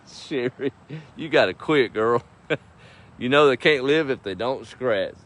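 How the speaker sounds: real chuckles around a loud, playful scolding; amused teasing. Real, not acted. Host teasing friend Sherry over an inside joke, amused. Joking with Sherry between laughs.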